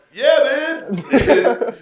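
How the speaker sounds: high, loud shout on the edge of laughter; retold shout back. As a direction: holler back to the next vehicle. Shouting back to truck driver.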